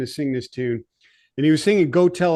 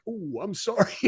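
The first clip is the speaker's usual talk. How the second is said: imitated small, high-pitched apology; sheepish apology; 6 semitones higher, 5 dB quieter than usual. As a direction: mimic a bandmate softly owning up, higher and quieter.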